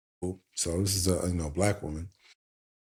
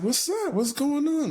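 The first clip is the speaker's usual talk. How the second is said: curious prodding. Retold or imitated. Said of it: "I was like".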